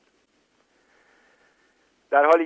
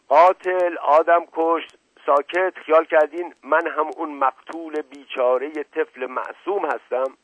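raised, higher voice in outrage; angry retort; 6 semitones higher, 4 dB louder than usual. Acted. Deputy under attack accuses the wife of murder, outraged. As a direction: hurl the accusation back at her.

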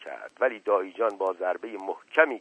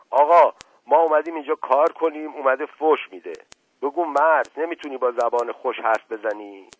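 loud, raised-pitch complaint; indignant protest; 5 semitones higher, 6 dB louder than usual. A narrator's reading. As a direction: protest hotly to the master about insults. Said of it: "نفس زنان گفت". (شکایت بلند و معترضانه)

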